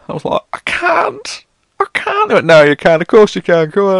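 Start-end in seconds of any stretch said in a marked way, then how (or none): 0.5-2.3 very high, strained pleading; high mock whine of I can't; pleading refusal. Refusing vendor, voiced pleading. 2.5-4.0 loud, high pushy seller; hammed-up vendor pressing a sale; insistent vendor. Vendor insists, voiced loudly.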